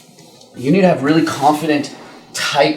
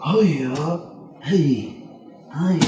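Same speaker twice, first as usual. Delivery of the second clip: choked talk; 5 dB quieter, much slower than usual. Shown as a demonstration. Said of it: "You hear the difference".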